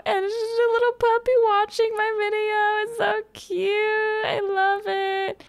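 put-on high baby voice cooing over a puppy; cooing at a puppy watching her video, in a high baby voice; baby talk voice. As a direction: coo like talking to a puppy. Gushing over puppy photo.

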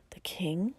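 breathless disbelieving whisper of the king; stunned whisper. Acted. Stunned echo of the news.

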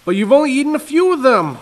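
fast, high, loud protest; protesting father. Acted. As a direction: rush the complaint.

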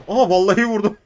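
loud, high gleeful shout into laughter; triumphant whoop. Real, not acted. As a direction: celebrate the kill. Got a kill, ecstatic.

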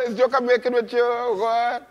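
ordinary talk

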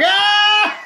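hammed-up loud laugh; loud fake laugh. Shown as a demonstration. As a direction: bray a fake laugh to mock her joke. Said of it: "I'm gonna have to get real loud on this again".

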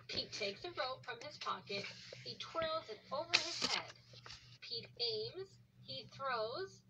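ordinary delivery, nothing marked

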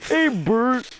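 high puppet-style call, swooping down and up; Ernie. Acted. Teasing a friend about his laugh, Ernie impression. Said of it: "turn into Ernie from Sesame Street".

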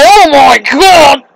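slow, loud stunned shout; shocked exclaim. Real, not acted. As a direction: cry out in shock. Shocked at game.

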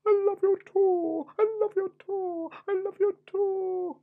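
very high, sing-song princess falsetto; hammed-up lovestruck princess; storybook princess. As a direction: coo it back in a squeaky high voice. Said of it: "And the princess said".

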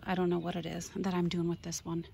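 usual speaking voice

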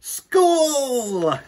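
put-on high, loud child's cheer; excited cheer. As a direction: burst out with joy. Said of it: "said Chrysanthemum".

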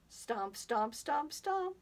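stomps climbing to a high finish; rising stomps. Pretend. Storyteller sending the last dinosaur off, stomps rising high.

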